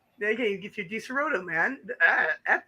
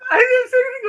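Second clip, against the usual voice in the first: indignant shout; 14 semitones higher, 8 dB louder, faster than usual. Real, not acted. Protesting loudly, laughing.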